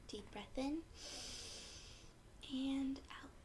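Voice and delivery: soft whisper, breath in and out; whispered breathing cue. Shown as a demonstration. Teacher guides children through breathing, in a whisper. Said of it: "Just breathe".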